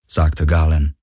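ordinary delivery, nothing marked

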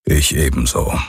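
deep, grumbled reply; terse low rumble of agreement; low grumble. A narrator's reading. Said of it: "brummte Hox".

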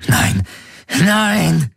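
second no leaps very high; desperate screech. A narrator's reading. Captive fighting his restraints, screeching. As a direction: cry out in rising, high protest. Possessor struggles, raging.

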